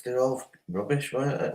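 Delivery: usual speaking voice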